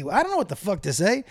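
ordinary talk, nothing marked

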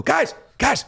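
high-pitched urgent alert to friends; hammed-up panicked call, kept at talking volume; high, panicky heads-up; mock panic. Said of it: "we all started to panic".

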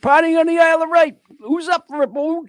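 high, loud hammed-up party whoop; rally the ghosts like a party hype man; rowdy party shout. Ghosts calling each other to party on the island, rowdy mock shout.

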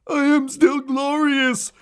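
slow glorious boast. Acted. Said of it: "your voice being like".

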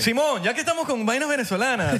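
tono alto y alargado de presentador; presentador anunciando. Acted. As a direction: anuncia el plato con pompa, alargando las palabras. Presenta la arepa al invitado. (presentación de anfitrión, aguda y pausada)

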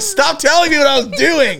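high, loud embarrassed protest; exasperated mock yell. Son begging mom to stop describing it, exasperated yell. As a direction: act out yelling at mom to stop.

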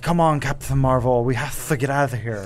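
put-on Australian accent with a heavy lisp; lispy Australian. Podcaster imitating an actor for the others, lispy Australian accent. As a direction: do a lisping Australian villain impression. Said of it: "but it's lispy".

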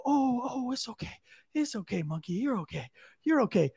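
put-on gently calm a scared crying kid; soothing a child. Officer soothing a crying toddler, soft and hushed.

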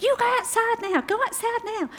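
high, insistent imitated calling; a lightly imitated high call; mock call. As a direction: repeat her order as she called it out. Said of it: "She said".

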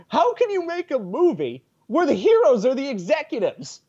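high, exasperated comic disbelief; incredulous rant. Retold or imitated. Relaying a bit about the FIFA movie, incredulous. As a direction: pitch it up like a baffled comic.

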